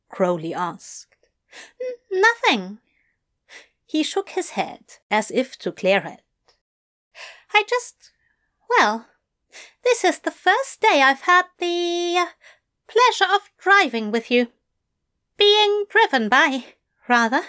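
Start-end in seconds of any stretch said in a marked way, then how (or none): 1.8-2.8 high, nervous stammer; flustered stammer. Acted. 7.5-17.4 high, hesitant, polite; nervous fussy angel. Acted.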